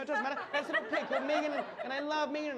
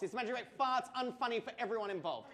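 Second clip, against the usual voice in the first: normal talking voice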